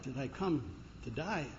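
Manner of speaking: quiet, taken-aback question; upset. Acted. American tourist echoing the cabbie, upset and hushed. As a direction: softly echo it, unsettled. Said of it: "The guy was kind of upset".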